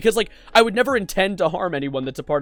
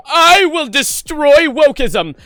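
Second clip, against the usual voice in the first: slow, higher, louder grand vow; hammed-up evil overlord line; mock villain declaration; 7 semitones higher, 8 dB louder, much slower than usual. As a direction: boom it like a cartoon villain. Mocking creator's tweets.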